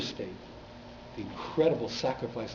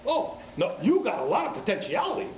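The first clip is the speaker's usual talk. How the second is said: excited taxi driver; 13 semitones higher than usual. Retold or imitated. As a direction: shout the praise like an overjoyed stranger. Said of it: "he says".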